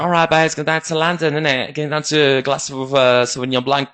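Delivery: mock upper-class English voice; posh English accent. Shown as a demonstration. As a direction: play a posh Londoner going for wine. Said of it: "je suis plutôt bon en imitation".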